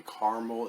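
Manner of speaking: caramel in two syllables, CAR-mul; CAR-mul pronunciation. Shown as a demonstration. Reviewer listing variants for viewers, caramel as CAR-mul. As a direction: say caramel as CAR-mul, middle vowel dropped. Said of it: "there may be other pronunciations".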